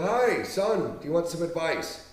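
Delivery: high, sugary mock offer from a rich man; mock rich mentor. Mocking a millionaire who calls out to a kid, in a high sugary voice. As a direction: play the kindly tycoon, overdone.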